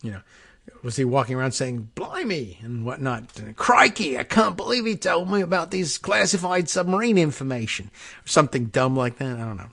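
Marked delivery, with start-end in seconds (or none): 1.9-2.6 mock Australian exclamation, soft and pitched up; jokey put-on Australian; Australian accent. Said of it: "does he have a strong Australian accent". 3.6-7.9 higher-pitched mock Aussie voice; jokey, dubious Australian impression; Australian accent. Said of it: "does he have a strong Australian accent".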